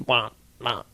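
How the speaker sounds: low, soft froggy croak; frog noise. Acted. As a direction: imitate a bloated frog's call. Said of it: "That's a frog noise".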